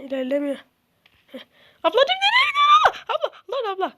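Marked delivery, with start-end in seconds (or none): 1.8-3.9 high yelling call; real shout at a game character. Real, not acted. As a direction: yell at her as she runs off.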